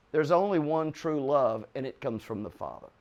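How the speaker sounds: ordinary talk, nothing marked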